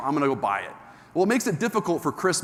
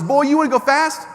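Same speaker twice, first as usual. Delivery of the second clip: higher, louder southern coach challenge; challenging instructor; 10 semitones higher, 6 dB louder than usual. Retold or imitated. Alabama instructor daring the timid driver, high and loud. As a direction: goad him to go faster.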